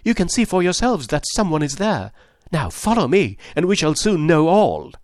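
urgent. Acted. Husband urging them to follow.

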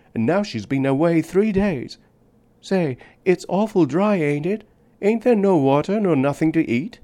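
put-on a thirsty small child rambling on; little girl. A narrator's reading. Little girl to the man in the desert, high chatty child voice.

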